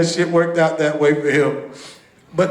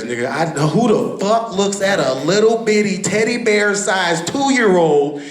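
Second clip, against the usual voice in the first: ordinary delivery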